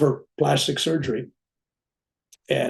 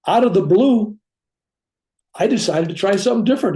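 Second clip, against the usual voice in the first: high, loud and quick; excited surgeon; 6 semitones higher, 4 dB louder than usual. Retold or imitated. Surgeon tells the wife of his inspiration, excited. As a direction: blurt out the surprise inspiration.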